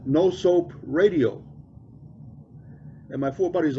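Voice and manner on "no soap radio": drawn out slowly, each word weighted; slow it right down on 'No soap radio'; the deadpan punchline, slowly. Retold or imitated. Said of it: "nice and slow".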